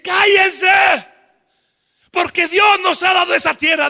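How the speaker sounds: loud high shout; Caleb shouting. Acted. Caleb shouts down the grumbling crowd. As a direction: roar at the grumbling people. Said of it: "dice Caleb".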